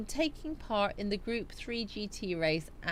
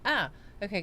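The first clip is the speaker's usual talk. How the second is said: usual speaking voice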